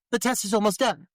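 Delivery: high-pitched urgent technician imitation; mock dramatic. Podcaster as lab technician during tense standoff, mock dramatic. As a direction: announce it with hammed-up urgency. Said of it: "this unnecessary dramatic bit".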